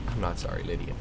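quiet, low muttered aside. Real, not acted. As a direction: say it under your breath. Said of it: "I am sorry".

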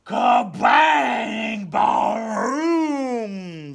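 huge high thunder shout; the thunder crashing right overhead; growling thunder. A narrator's reading. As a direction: blast the thunder out. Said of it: "the thunder growled".

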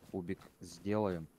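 usual speaking voice

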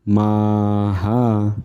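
माहा with both vowels held long. Shown as a demonstration. Teacher shows the ending, माहा drawn out long. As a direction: stretch माहा slowly, final हा sounded. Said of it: "मला एक काना".

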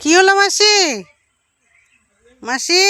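high, loud call with rising end. Real, not acted. Vlogger hails an upset aunt on the roadside, calling out. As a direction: call over to someone along the road.

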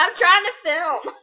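loud exasperated protest; annoyed shout. Real, not acted. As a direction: tell him to stop while filming. Annoyed at brother.

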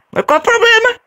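high, loud squeaky retort; squeaky mascot voice. Acted. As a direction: talk back like a cartoon mascot. Mascot voice talking back.

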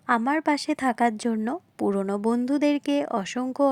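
normal talking voice

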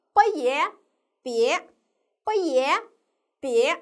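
不也 in two parts, then blended into 别, firmly; syllable blending demo. Shown as a demonstration. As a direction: sound out 不也, pause, then 别 with a rising tone. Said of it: "我们可以怎么拼呢".

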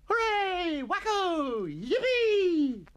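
very high, loud schoolboy cheers; unrestrained joy. A narrator's reading. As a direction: whoop it up like boys let off school. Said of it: "received with unrestrained joy".

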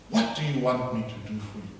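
ordinary talk

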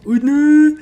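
high, loud shocked cry; shocked shout. Real, not acted. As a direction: yelp in alarm. Shocked outburst at news.

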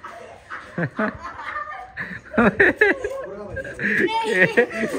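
real high laughing fit; long high laugh. Real, not acted. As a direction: burst of high delighted laughter. Bursts out at Ali's antics.

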